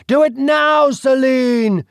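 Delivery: a high, loud barked command; commanding order. Acted. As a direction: force her to strike now. Duke commanding her.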